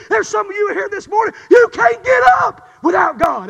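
loud, rising sermon build; full-force preaching. Real, not acted. Preacher crying out to his congregation, loud and rising. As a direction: preach it out at the top of your voice.

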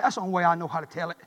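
ordinary talk

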